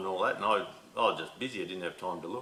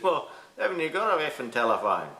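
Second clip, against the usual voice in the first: laughing while quoting; 7 semitones higher than usual. Retold or imitated. Retelling mate's phone rant, laughing.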